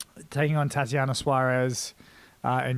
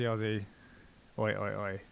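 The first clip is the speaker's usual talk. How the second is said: ordinary talk, nothing marked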